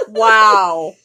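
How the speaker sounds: normal talking voice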